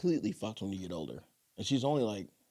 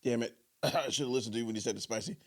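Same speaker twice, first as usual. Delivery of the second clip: ordinary delivery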